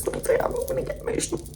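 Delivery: high, quiet, choked-up voice; getting emotional. Pretend. Stylist overcome by the client's look, mock emotional. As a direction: play at welling up, soft and squeaky. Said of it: "I'm getting emotional".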